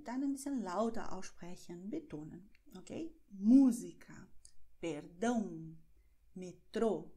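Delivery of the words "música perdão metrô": Música hit on MÚ, Perdão on DÃO, Metrô on TRÔ; accent marks tell where the weight falls; stress on accented syllable. Shown as a demonstration. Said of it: "So klingt es".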